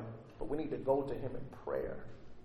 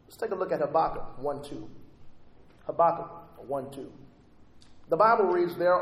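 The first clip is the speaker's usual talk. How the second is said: normal talking voice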